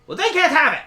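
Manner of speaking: loud, high, defiant bark; barking pawn. Acted. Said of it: "you hear the pawn bark".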